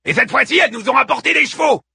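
high, fast gloating shout; filthy scavenger. A narrator's reading. Looter gloats that women brought horses, jeering shout. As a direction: crow loudly like a crude looter. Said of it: "le plus crasseux des charognards".

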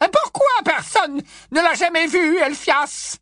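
put-on high, loud outcry from an old aunt; high loud aunt crying out accusingly. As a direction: cry it out at Elphias. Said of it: "s'écria la tante Muriel".

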